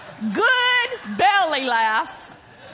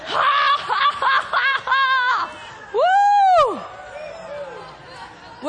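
faked laughter exercise; 8 semitones higher, 3 dB louder than usual. Shown as a demonstration. Preacher leading congregation in a little laughing, faked laughter. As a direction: force each laugh out, then whoop. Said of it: "Let's do a little".

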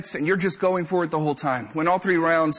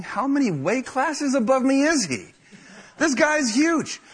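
dismayed disbelief; 7 semitones higher than usual. Retold or imitated. Sizing up huge opponent.

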